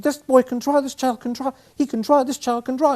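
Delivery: very high, loud, repeated exclamation; can't stop saying it; ecstatic nurse. Retold or imitated. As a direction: nurse tells the man at the desk, very high and thrilled.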